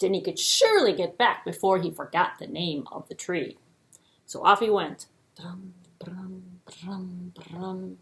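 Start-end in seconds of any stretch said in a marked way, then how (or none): 5.4-8.0 soft whispered drum beat; whispered drumming. A narrator's reading. Storyteller whispering Eland's hoofbeats as he runs off.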